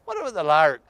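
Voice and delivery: ordinary talk, nothing marked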